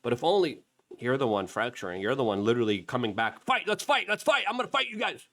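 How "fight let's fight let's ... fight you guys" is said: high, mocking fighter voice; mock tough guy. Host mocking Bongino's call to fight, in a high, jeering voice. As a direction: sneer it like a petulant brawler.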